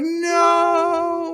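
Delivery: high, mock anguished wail of no; horrified cry. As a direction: cry out as the dog falls. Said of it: "And I'm like".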